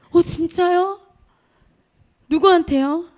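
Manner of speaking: surprised. Retold or imitated. 놀라서 되묻는 회상.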